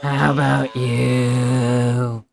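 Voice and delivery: very slow, raspy smoker's voice; chainsmoker voice. Shown as a demonstration. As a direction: drag it out like a lifelong smoker. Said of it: "the goddamn Chainsmoker voice".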